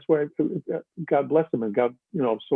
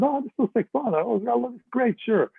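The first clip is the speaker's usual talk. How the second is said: eager; 11 semitones higher than usual. Retold or imitated. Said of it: "I said".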